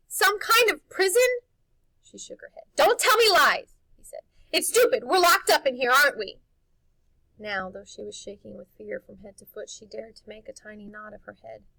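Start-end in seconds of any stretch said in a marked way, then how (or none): 0.1-6.4 high, loud furious demand; boy shouting accusations at the housekeeper; angry accusation. Acted. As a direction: confront her about the prison. Harvey confronting her.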